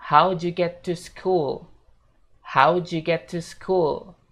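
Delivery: "How'd you get to school" slow, "how'd you" joined as "howju"; slow linked "how'd you". Shown as a demonstration. Teacher models the full linked question slowly. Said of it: "Jadi fullnya bakal kayak gini".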